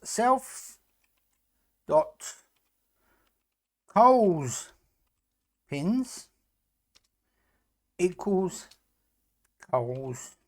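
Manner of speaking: slow word-by-word dictation; dictating. Shown as a demonstration. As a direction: spell out code piece by piece. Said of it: "dictating code word by word".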